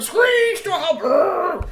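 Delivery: very high, slow screech; screeching brakes. A narrator's reading. Narrator voicing the police car's stop. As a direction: make the car skid. Car sound effect.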